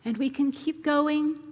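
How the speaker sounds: ordinary delivery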